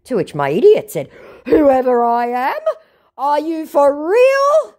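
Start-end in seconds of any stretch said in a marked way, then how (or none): 1.5-4.7 high, slow mock-outraged squeak; offended scammer. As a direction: ham up his wounded pride.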